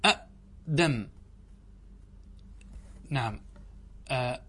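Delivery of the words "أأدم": أأدم said slowly, glottal stop, then again before the long a; teacher showing the original form أأدم; two hamzas sounded. Shown as a demonstration. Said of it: "أصله".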